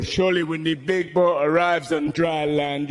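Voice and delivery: ordinary talk, nothing marked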